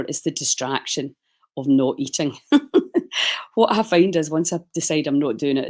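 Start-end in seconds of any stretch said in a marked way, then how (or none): 2.4-3.5 a quick breathy laugh at herself; short laugh. Real, not acted. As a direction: laugh off the thought of not eating. Laughs at own fasting remark.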